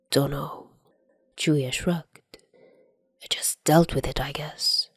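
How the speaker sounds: brushing it off under his breath; quiet shrug. Acted. Chuya deflecting Dazai's question about the past, quiet murmur.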